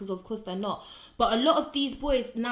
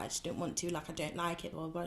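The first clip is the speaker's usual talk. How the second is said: put-on sulky teenage mumble; 3 semitones lower, 11 dB quieter, faster than usual. Her reluctant reply to teacher.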